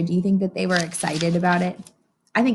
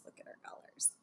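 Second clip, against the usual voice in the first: plain reading voice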